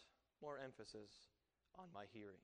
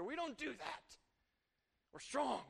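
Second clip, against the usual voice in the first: mock manly protest; 8 semitones higher than usual.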